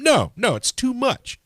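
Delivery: high, loud burst of protest; worked-up rant boiling over into a shout; real exasperated shouting. Real, not acted. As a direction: shout it out, pitch leaping up. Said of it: "Okay, you're shouting now".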